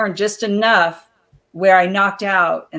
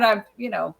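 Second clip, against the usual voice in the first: normal talking voice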